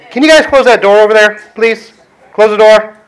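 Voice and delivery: calling out. Real, not acted. Preacher asking people at the back to close the door, calling out. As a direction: call out to them to shut the door. Calling out to congregation mid-sermon.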